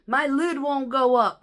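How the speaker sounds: a whiny complaint, loud and slow; mock whine. Mocking the coworker who cries about everything, mock whine. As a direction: whine it like a big baby. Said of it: "This guy cry about everything".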